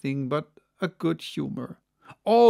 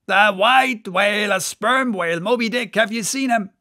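higher, louder call; 5 semitones higher, 5 dB louder than usual. Acted. Stubb presses the Guernsey mate, calling out. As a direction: insist across the water.

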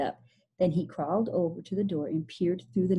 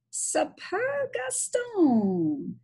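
drawn-out high exclamation; 12 semitones higher, much slower than usual. Acted. Billy's weird exclamation after eating worm.

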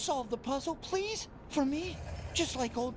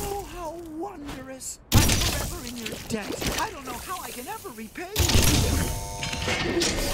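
gushing gratitude; 4 semitones higher, 6 dB louder than usual. Acted. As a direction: lay the gratitude on thick. Grateful imposter thanks, louder.

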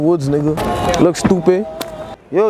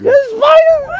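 high panicked scream; screaming at a spider; 6 dB louder, slower than usual. Real, not acted. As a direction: scream in real fright at a spider. Spider found on his back.

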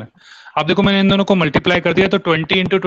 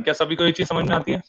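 ordinary talk, nothing marked

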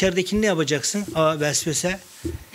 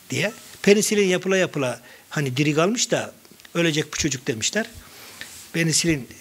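ordinary talk, nothing marked

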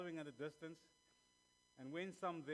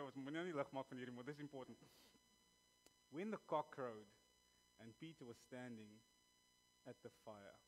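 normal talking voice

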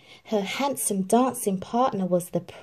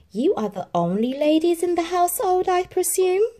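higher, formal courtly query; polite official asking; Grand Duke; 11 semitones higher, 4 dB louder than usual. Acted. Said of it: "said the Grand Duke".

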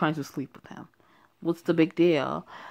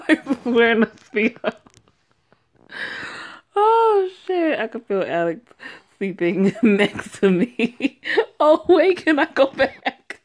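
loud, high words breaking into laughs; laughing uncontrollably; 7 semitones higher, 7 dB louder than usual. Real, not acted. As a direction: lose it laughing mid-story. Cracking up over the Speedo.

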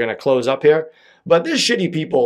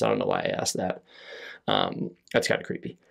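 sudden drop to a near-whisper, much softer; hushed aside; 10 dB quieter than usual. Real, not acted. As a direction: mutter it to yourself, creeped out. Creepy aside, dropping to a hush.

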